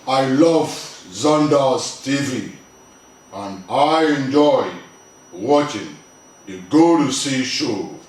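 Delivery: slow, even robot voice. Shown as a demonstration. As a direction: spell it out like a machine. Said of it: "talk like a robot".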